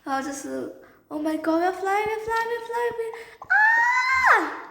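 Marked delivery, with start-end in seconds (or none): 1.1-3.5 rising steadily into a high-pitched panic; panicked takeoff babble. Acted. Nancy panics as plane takes off. 3.5-4.6 loud, high takeoff scream. Acted.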